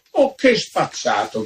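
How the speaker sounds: outraged cry. A narrator's reading. Countess, losing her temper, cries out in outrage. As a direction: cry out at his cheek. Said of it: "gridò la contessa".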